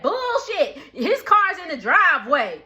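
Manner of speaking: high, forceful call-out; accusing retort. Retold or imitated. Brooke disputing the stepdad's excuse, accusing. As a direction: act out catching someone in a lie. Retold angry accusation at the door.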